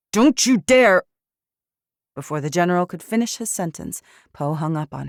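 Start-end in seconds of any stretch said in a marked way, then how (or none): 0.1-1.0 loud, high furious yell; furious shout. Acted. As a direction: scream it at the dead line. General yells as call ends.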